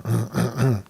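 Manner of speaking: harsh coughing fit; choking cough. Real, not acted. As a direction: cough out what went down the wrong pipe. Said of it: "don't wanna cough in your ear".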